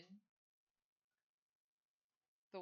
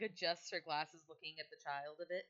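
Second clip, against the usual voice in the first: usual speaking voice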